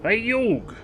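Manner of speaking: hammed-up Brooklyn accent calling out to a buddy; Joey Wheeler Brooklyn accent. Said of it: "With his terrible Brooklyn accent".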